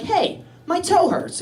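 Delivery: high, slow little complaint; simple-patient complaint. Retold or imitated. Patient talking to a doctor about his toe, simple complaint.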